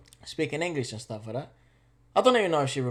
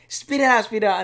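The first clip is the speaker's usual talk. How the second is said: louder stern teacher order; stern teacher command; 6 semitones higher, 7 dB louder than usual. Retold or imitated. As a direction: bark it like a strict teacher. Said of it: "then she was like".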